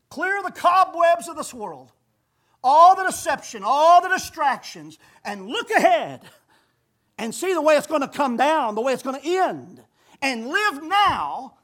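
thundering sermon climax; full-force preaching. Acted. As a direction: drive each phrase home from the pulpit. Preaching crescendo.

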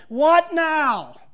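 mock frustration at a setback; frustrated what now. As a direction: throw up your hands at it. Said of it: "in frustration or excitement".

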